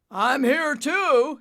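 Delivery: slow, loud booming call. Acted. Father booms back to his son, slow and loud. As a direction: boom it back slowly. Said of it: "he boomed back".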